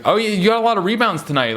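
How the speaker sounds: high, fast eager reporter voice; mock the gushing reporter. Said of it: "He's like".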